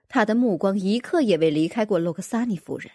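ordinary delivery, nothing marked

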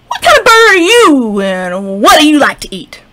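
loud high frog question; brash frog. Acted. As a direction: challenge the bird cockily.

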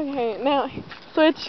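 ordinary talk

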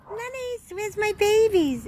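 squeaky high call to the dogs, light and sing-song. Real, not acted. Owner calling her rescue dogs in baby talk. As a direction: pitch way up, calling for the little ones.

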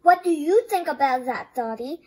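ordinary delivery

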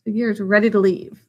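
ordinary delivery, nothing marked

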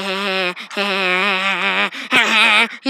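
low, drawn-out goofy cackle; mock laugh. Imitating little brother who's aiming the rifle, mocking laugh. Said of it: "he laughed, and he was like".

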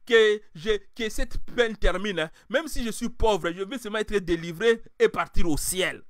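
full-force sermon delivery; fiery preaching. Acted. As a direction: rouse the congregation.

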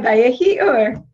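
loud, slow mock Russian-Yiddish accent; Aunt Celia. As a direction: proclaim it like a feisty aunt with an Eastern European accent. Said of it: "she'd say in a little Russian accent".

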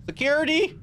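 nervous shout of security; calling out. Real, not acted. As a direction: announce yourself to whoever's there. Calling out in dark building.

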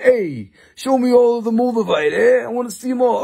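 Sam impression. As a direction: play Sam as a breezy big spender. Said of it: "he said".

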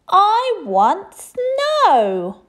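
high, loud, very slow drawn-out whine; whiny demand. A narrator's reading. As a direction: stretch the refrain out. Princess's repeated refrain.

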